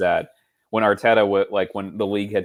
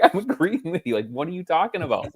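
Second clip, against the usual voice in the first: exasperated; 10 semitones higher than usual. Retold or imitated. Retold exasperated reply online.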